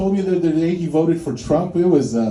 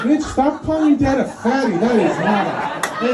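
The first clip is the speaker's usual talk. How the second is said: mock outrage; 6 semitones higher than usual. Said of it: "And I'm like".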